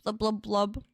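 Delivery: bubbly fish blub noises; gurgling fish man croak. Pretend. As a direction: blub like a goldfish underwater. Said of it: "It's me, a goldfish".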